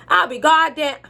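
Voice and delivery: high, put-on country exclamation; country accent. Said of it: "I'm gonna cut the accent".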